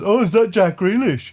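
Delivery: high, loud mock amazement through laughter; excited gobshite mate. Mocking his mate's false sighting at the derby, excitedly. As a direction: imitate a mistaken friend, laughing as you go. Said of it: "this gobshite then sees the greasiest looking man".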